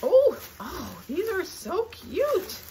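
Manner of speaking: high, loud, drawn-out cooing; delighted squeal. Real, not acted. Collector finding mini cola bottles, delighted squeal. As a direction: squeal slowly over something adorable.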